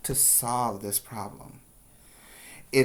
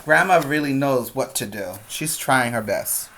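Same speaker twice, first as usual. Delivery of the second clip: ordinary delivery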